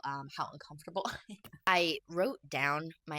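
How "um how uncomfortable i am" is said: an uncomfortable laugh slipped in; nervous laugh. Real, not acted. Vlogger admitting discomfort to viewers, nervous laugh. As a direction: laugh off the awkwardness. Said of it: "I don't know if you could tell".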